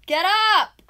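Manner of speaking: very high, very loud shout; full-out wake-up yell. Real, not acted. Girlfriend rousing sleeping boyfriend, yelling. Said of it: "I normally yell like this".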